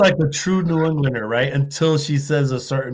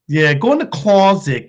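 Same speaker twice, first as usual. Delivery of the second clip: ordinary talk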